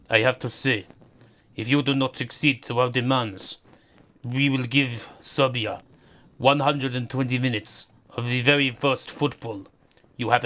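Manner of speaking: lightly Russian-accented mock Putin speech; a hint of a Russian accent, threatening Serbia as Putin; Putin impression. Mock Putin addresses Russians with a light Russian accent. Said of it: "Putin threatening Serbia".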